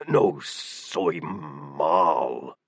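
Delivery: slow, low rasping growl; raspy voice. A narrator's reading. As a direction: drag each word out in a harsh rasp. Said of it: "he spoke in his horribly raspy voice".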